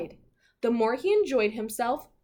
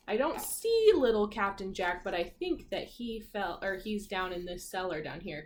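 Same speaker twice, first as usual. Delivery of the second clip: usual speaking voice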